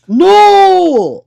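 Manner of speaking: long, high, booming NOOO; emphatic shout. Real, not acted. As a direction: bellow a drawn-out no. Emphatic reply to a viewer comment.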